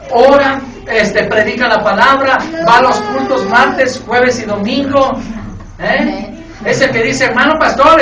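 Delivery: loud, driving sermon delivery; full-force preacher; fiery preaching. Real, not acted. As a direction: preach it hard to the congregation. Preaching, acting God boasting.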